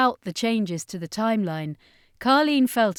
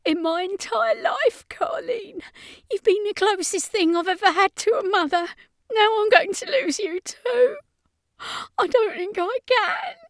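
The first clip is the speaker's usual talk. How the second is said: sobbing; 13 semitones higher, 4 dB louder than usual. A narrator's reading. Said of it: "Ashley sobbed".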